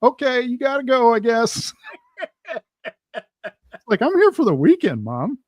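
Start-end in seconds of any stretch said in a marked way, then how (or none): 0.0-1.7 high, loud put-on mom voice; mock-resigned send-off, pitched way up; imitated mom's goodbye. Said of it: "I got that from my mom". 4.0-5.4 high, loud protest dropping on the last word; exasperated grown kid, played for laughs; mock exasperation. Acted exasperated reply to mother.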